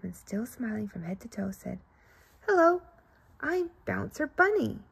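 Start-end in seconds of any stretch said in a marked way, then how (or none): 2.5-4.8 lightly voiced bright bunny, higher; a hint of a bouncy little bunny greeting; lightly played friendly bunny; cheerful bunny. Bunny character greets bear cub.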